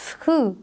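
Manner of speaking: short isolated स्कू; teacher models the sch sound; sounding out sku. Shown as a demonstration. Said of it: "स्कू की sound".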